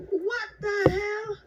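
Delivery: high, slow and stretched out; drawn-out disbelief. Retold or imitated. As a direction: drag out each word in shocked disbelief. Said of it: "I'm like".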